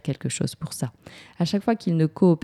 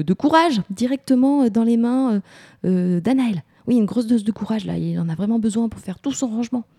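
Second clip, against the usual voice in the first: higher, pleading mock phone call; raised-pitch appeal to a storybook friend; calling a hero for help; 6 semitones higher than usual. Shown as a demonstration. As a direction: play urgently ringing an imaginary hero. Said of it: "on peut l'appeler aussi".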